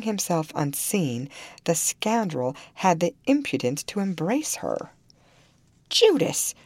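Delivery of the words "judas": high, loud cry of accusation; outraged exclamation. A narrator's reading. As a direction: burst out in indignation. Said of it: "I ejaculated".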